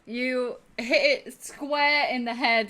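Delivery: ordinary delivery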